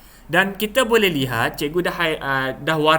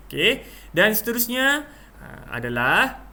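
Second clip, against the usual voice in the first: ordinary talk, nothing marked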